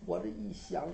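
plain reading voice

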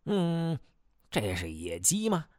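puzzled, blurting out a question; puzzled surprise. A narrator's reading. Chief inspects an unfamiliar bird, puzzled surprise. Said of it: "惊讶地看着祈寿院老板怀里的孔雀".

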